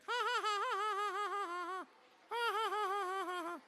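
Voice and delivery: put-on very high baby giggle; baby laugh. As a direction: squeal out a rapid baby "ha-ha". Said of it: "he laughed all the time".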